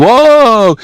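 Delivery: high, drawn-out "whoa"; alarmed whoa. Acted. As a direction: react like a crowd watching a wobble. Crowd gasps as wheelbarrow shakes.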